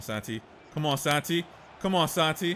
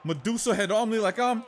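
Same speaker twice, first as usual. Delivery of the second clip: ordinary talk, nothing marked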